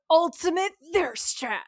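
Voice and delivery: loud, high, drawn-out proclamation; hammed-up gushing. Host reading out a gushing note about Dean, hammed-up. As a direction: belt it out slowly like a headline.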